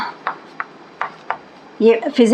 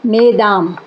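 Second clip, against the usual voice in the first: French plural; 3 semitones lower, slower than usual. Shown as a demonstration. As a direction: say मेदाम the French way, no s. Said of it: "ये French शब्द है". (मेदाम French उच्चारण में)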